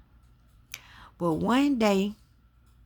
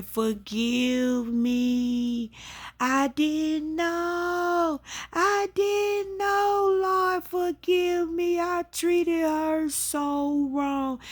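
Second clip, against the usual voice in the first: high, slow, drawn-out wail imitating her mother; retold anguished begging, stretching out the words; crying plea; 11 semitones higher, much slower than usual. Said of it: "She was crying".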